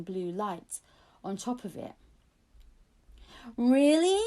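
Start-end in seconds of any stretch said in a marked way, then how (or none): 3.6-4.2 high, loud delighted gasp of a question; a boy who can't believe his luck; excited. Acted. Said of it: "his eyes lighting up".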